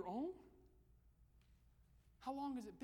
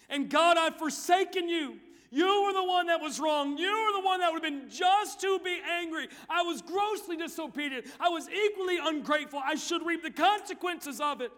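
loud confession prayer; 8 semitones higher, 17 dB louder than usual. Acted. Teenagers told to pray their repentance aloud. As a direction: cry it out as a prayer.

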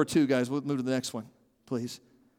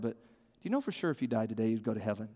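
quiet earnest ask; soft sincere question; 6 semitones lower, 8 dB quieter than usual. Shown as a demonstration. Preacher modelling the key question in a soft voice. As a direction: lower and softer, like a concerned friend. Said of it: "But to ask someone is to say".

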